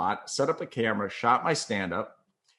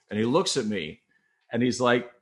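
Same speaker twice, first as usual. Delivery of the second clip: normal talking voice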